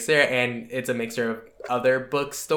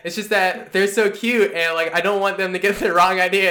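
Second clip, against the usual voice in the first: flustered excuse; 6 semitones higher, 5 dB louder than usual. Retold or imitated. Acting the hero's excuse.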